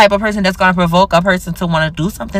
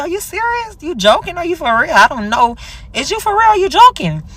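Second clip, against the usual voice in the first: incredulous; 8 semitones higher than usual. Retold or imitated. Confronting him over the camera.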